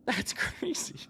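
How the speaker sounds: breathless hushed whisper; awed whisper. Real, not acted. Gamer recovering after the fight, hushed whisper. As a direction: whisper it in awe.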